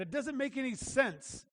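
ordinary delivery

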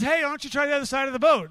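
high, loud, quick holler to a boat; calling out. Acted. Man on shore hails tired fishermen, calling out. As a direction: call a breezy suggestion across the water. Stranger calls advice to boat.

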